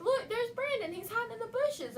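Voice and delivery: put-on high little-kid voice; lightly voiced two-year-old pointing out a friend; toddler voice. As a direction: pitch up to play the small nephew. Voicing two-year-old nephew.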